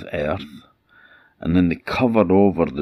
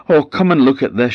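frightened call; 8 semitones higher, 7 dB louder than usual. Acted. Wife calls husband to the byre.

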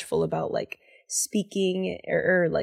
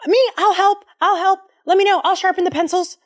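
put-on eager kid volunteer; 12 semitones higher, 6 dB louder than usual.

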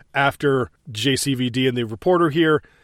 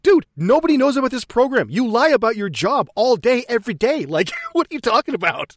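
high, incredulous mock rant; mock exasperated telling-off; 8 semitones higher than usual. Colonel setting the scientist straight about secrecy, mock exasperated. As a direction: lecture him in pitched-up disbelief.

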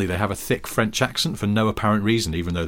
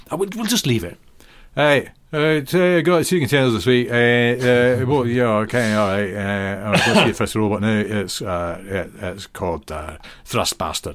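put-on Glaswegian-accented host, hesitant and rambling; Glaswegian robot host. Asimo introducing the first robot in a thick Glaswegian accent. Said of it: "quite a thick Glaswegian accent".

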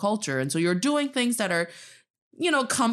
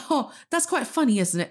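mock proper British accent, pitched up; British accent impression; 6 semitones higher than usual. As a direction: put on a posh British accent for fun. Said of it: "he talks like this".

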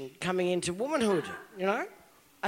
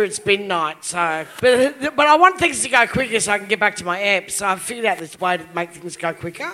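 ordinary talk

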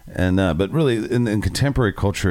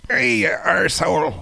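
put-on Scottish accent; 11 semitones higher than usual. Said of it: "if I do a Scottish accent".

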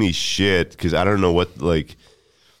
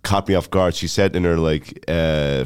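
usual speaking voice

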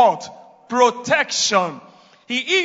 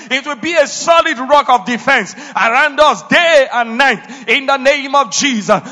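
fervent prayer; 4 semitones higher, 8 dB louder than usual. Real, not acted. Intercession for church.

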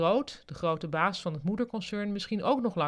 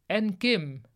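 slightly raised name call; announcing; 4 dB louder than usual. A narrator's reading. The big boss honours departing Kim, announcing. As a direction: present the next honouree.

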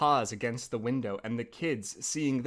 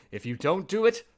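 higher, louder wolf threat; wolf crying out; 5 semitones higher, 5 dB louder than usual. Acted. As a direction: threaten the miller, voice raised. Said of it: "cried the wolf".

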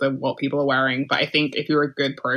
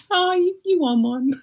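high-pitched mock tenderness; mock cooing; 12 semitones higher than usual. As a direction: coo it much higher, as if touched for him. Mock reaction to his Oscar win.

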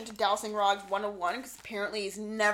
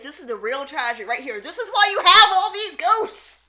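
rising to a much higher, much louder mock lament; mock dramatic wail; 4 semitones higher, 13 dB louder than usual. Friend mocking Zach's grief over a car, hammed-up lament. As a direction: ham up a heartbroken ghost hunter.

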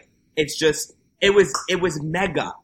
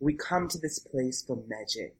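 soft, dreamy voiceover read, much quieter; hushed Nicole ad voice; 8 dB quieter than usual. Retold or imitated. Recapping the AMC ad to her co-host, in a hushed, imitated voiceover. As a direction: breathe it like a movie-trailer narrator, lightly imitated. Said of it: "and then the V O starts".

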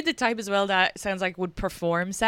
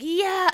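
high mock moaning "yeah"; 11 semitones higher than usual. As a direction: moan it out high and breathy. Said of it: "it would be like".

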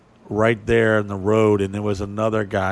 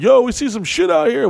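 high, loud mock-alarmed blurt; 14 semitones higher, 6 dB louder than usual. The guys imagining blurting it to the stranger, high and loud. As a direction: ham up the scared tourist. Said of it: "we don't wanna be like".

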